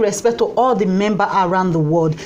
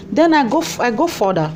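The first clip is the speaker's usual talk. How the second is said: ordinary talk, nothing marked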